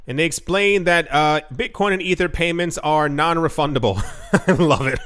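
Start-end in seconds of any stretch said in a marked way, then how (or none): 4.4-5.1 chuckling with real amusement; tickled, breaking into a laugh; amused laughing. Real, not acted. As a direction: laugh through the words. Laughs at non-refundable payments.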